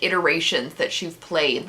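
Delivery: ordinary talk, nothing marked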